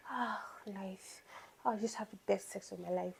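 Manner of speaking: soft, lazy blissful voice, lightly done; mock dreamy contentment. Lazy woman basking after sex, in a mock dreamy murmur. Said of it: "you just wanna lie down there like".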